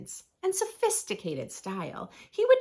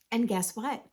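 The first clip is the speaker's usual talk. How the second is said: plain reading voice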